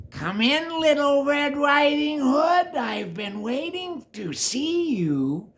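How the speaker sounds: high, slow, loud put-on sweet voice; the wolf overplays a sweet grandma; funny wolf voice. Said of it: "the wolf used a funny voice".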